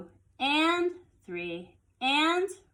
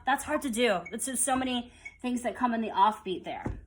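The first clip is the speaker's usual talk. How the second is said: ordinary talk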